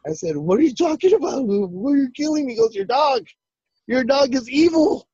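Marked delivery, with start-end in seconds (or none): 0.4-2.5 high-pitched retold disbelief; bewildered protest. Bewildered reply to raging uncle. 2.7-5.1 high-pitched imitation of a furious uncle; yelling accusation. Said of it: "he's yelling and screaming".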